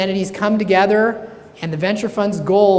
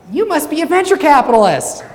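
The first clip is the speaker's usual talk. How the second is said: knowing punchline; 10 semitones higher, 7 dB louder than usual. Acted. Lady's punchline guess.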